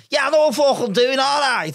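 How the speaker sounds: high, irritable mock Scouse; Scouse bus driver. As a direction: snap back in an exaggerated Scouse accent. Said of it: "it's a Scouse bus driver".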